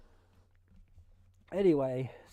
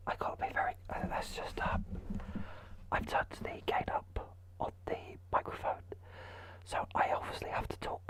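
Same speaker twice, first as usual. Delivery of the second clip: soft breathy whisper; 5 dB quieter than usual. Shown as a demonstration. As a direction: whisper close to the mic. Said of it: "I'll try my sleepy voice".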